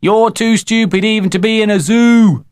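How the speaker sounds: put-on high, loud boyish taunt; jeering high-pitched call; cried out. A narrator's reading. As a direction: shout an insult across a field. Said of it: "cried Jeremy James".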